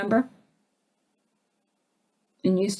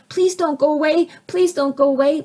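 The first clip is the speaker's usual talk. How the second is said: put-on higher, childlike begging; pleading inner child; 7 semitones higher, 4 dB louder than usual. Little kid inside begging parent not to leave, high pleading. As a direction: plead like a small scared kid. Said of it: "kid inside of you is going".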